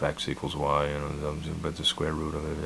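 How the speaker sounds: low, soft mumble under the breath; mumbling to himself. Retold or imitated. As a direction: mutter the math to yourself. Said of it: "mumbling to myself".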